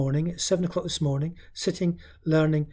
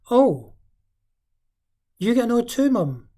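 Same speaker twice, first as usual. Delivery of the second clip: put-on high surprised child, first to mum; a kid discovering mum gets annoyed too; child's voice; 7 semitones higher, 8 dB louder than usual. Parent imitating a child realising mum gets annoyed.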